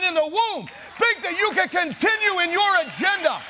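rally the congregation to fight; thundering sermon build to a shouted peak; fiery preaching. Acted. Preaching at full force.

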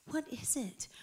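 whispered question, almost no voice; hushed reply. Retold or imitated. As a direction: imitate a timid, whispered response. Retold startled reply to the plea.

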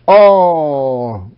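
"all" long drawn out and heavily emphasized; stress on "all". Real, not acted. Preacher underlining a verse's key word, "all" emphasized. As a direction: lean hard on "all" and stretch it. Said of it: "I emphasize that word".

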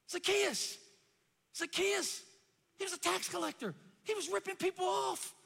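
high, insistent sermon cry; full-force preaching. Acted. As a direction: call out the sinner's case to the congregation.

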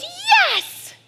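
high, loud whoop; excited cheer. Retold or imitated. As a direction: cheer like a delighted dad. Dad cheers at the news.

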